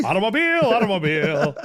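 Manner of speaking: put-on old-time megaphone mid-Atlantic voice; exaggerated vintage mid-Atlantic twang; mid-Atlantic accent. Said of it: "the mid-Atlantic accent".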